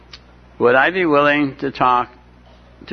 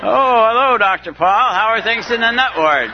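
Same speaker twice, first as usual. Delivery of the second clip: mock cheery greeting; 8 semitones higher than usual. Imagined patients greeting through glass.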